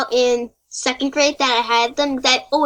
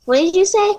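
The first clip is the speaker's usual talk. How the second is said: higher, questioning lift; puzzled retold question; 8 semitones higher than usual. Girl without implants asking a kid to repeat, puzzled. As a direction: act out not having caught it.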